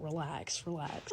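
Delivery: calm them down gently; telling worried viewers to calm down, soft and low; soothing hush. Pretend. Calming the audience down.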